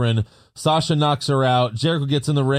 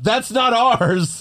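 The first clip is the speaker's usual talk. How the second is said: mild mock yell; 8 semitones higher, much slower than usual. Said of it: "Dana yells at the ref".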